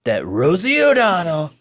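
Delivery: high, brassy Trump voice pushed from the throat; hammed-up presidential voice building to a shouted sign-off; Trump impression. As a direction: do Trump announcing a decree, big and pompous. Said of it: "Every time I do this guy's voice".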